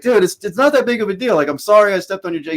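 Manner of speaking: high, loud, rushed apology; exasperated retold protest. Retold protest to angry Frenchman.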